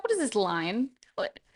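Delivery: ordinary delivery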